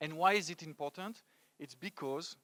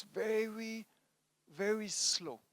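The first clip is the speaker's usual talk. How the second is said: slow, higher-pitched VERY, stretched out; drawn-out slowness; 7 semitones higher, slower than usual. Real, not acted. Speaker describing French confidence to the audience, drawn-out slowness. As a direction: drag out "very, very slow" to show the slowness.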